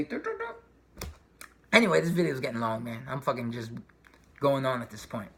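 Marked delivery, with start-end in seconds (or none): 0.0-1.1 robotic beeping. Pretend.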